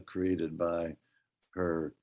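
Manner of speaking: usual speaking voice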